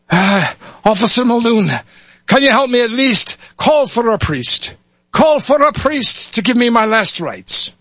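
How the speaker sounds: high pleading Irish brogue; Irish lad. Acted. Dying Irish lad begs the officer for a priest, pleading Irish accent. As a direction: beg in a young Irish voice. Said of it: "switch between dialects here".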